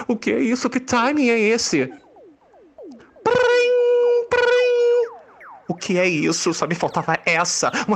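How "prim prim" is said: beeping ringing tone; high ringtone imitation. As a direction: falsetto phone ring. Phone ring imitated.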